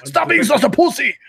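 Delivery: high-pitched mock Austrian accent; hammed-up Austrian action-hero taunt; Arnold.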